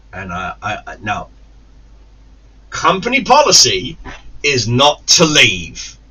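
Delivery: usual speaking voice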